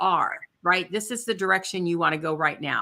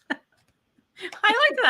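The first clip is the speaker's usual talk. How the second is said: laughing; 13 semitones higher, 3 dB louder, much slower than usual. Real, not acted. Laughs at the viewer's guess.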